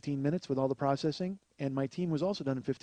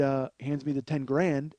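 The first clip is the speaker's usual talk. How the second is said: usual speaking voice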